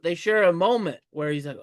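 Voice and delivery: normal talking voice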